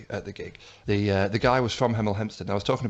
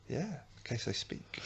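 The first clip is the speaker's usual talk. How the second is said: slow, much softer dry aside; wry smirk; 2 semitones higher, 15 dB quieter than usual. Real, not acted. Co-host explaining the tortoise hostages, wry smirk. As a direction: say it slowly and softly through a smirk. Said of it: "with a little wry smirk".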